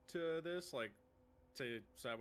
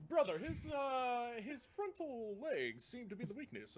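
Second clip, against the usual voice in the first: orc shouting advice; 10 semitones higher, 3 dB louder than usual. Acted. Orc advising brother from booth.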